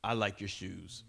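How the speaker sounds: mock compliment. Churchgoer complimenting a disliked coworker, lightly voiced. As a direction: hand the compliment over in a held-back voice. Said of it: "and say".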